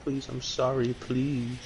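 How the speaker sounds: begging voice. Retold or imitated. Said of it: "and said".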